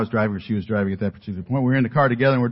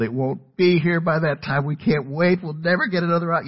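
mock wailing; 8 semitones higher than usual. Son protesting to his mom about waiting, mock wailing. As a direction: wail it out like a thwarted kid. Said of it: "of course, I wailed".

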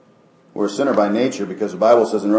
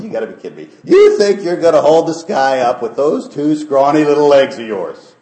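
a hint of scoffing, louder; mocking disbelief; 6 semitones higher, 9 dB louder than usual. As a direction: scoff at the little bird. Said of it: "he just laughed out loud".

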